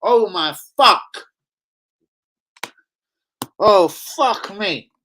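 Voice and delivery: slow, drawn-out exasperated groan; fed-up groan at boiling point. Real, not acted. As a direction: drag it out in fury. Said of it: "I'm at boiling point".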